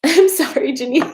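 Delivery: high, loud apology breaking into laughter; tearful laughing. Real, not acted. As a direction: apologise through laughter and tears. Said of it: "I had stopped crying today".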